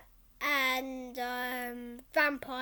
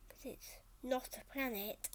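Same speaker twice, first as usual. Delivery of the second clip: whisper; 2 semitones lower, 14 dB quieter than usual. Real, not acted. Child whispering aside.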